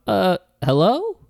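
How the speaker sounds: calling out. Acted. Calling out of the window.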